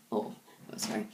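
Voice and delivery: hushed, tearful apology; barely voiced words through real tears; real crying. Real, not acted. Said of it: "sorry, crying again".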